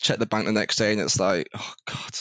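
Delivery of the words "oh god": breathy whispered groan; whispered dread. Retold or imitated. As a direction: whisper it in horror at the bill. Checking the bank the next day.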